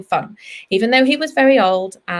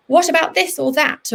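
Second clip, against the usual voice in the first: acted sudden wondering, pitched higher; sudden question; 5 semitones higher than usual. Vet acting out a child's sudden question, mock surprise. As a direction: ask it as if it just struck you. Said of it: "you suddenly have a question".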